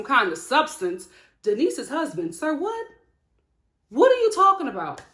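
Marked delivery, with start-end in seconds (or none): 2.3-5.0 high-pitched, incredulous questioning; baffled disbelief. Retold or imitated. Host confronting a rambling intoxicated husband, baffled. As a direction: demand an explanation, pitch jumping up.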